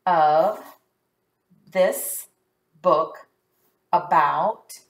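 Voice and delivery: one word at a time, gaps between; halting teacher read-along. Shown as a demonstration. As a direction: read each word on its own. Reading word by word while decoding.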